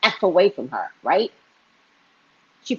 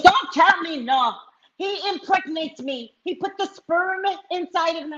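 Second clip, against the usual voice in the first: put-on insistent old woman; 11 semitones higher than usual. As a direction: insist hard in her high voice. Woman insists she's pregnant.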